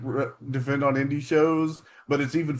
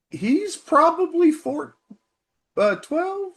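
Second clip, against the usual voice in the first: mock high voice; 13 semitones higher, 3 dB louder, slower than usual. Joking guess at Jericho's age.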